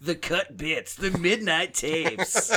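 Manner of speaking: high, drawn-out mock announcer; hammed-up slow radio hype. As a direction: announce it like a late-night tape commercial. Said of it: "F M D J voice".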